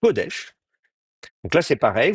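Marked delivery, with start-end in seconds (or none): 0.0-0.5 Kodesh stressed on ko, raised pitch; stress on first syllable. Shown as a demonstration. Teacher models Kodesh with the stress on ko. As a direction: say Kodesh, leaning on ko. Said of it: "l'accent sur la première syllabe".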